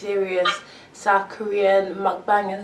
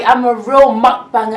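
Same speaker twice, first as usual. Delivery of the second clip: proud; 8 dB louder than usual. Real, not acted. Proud boast.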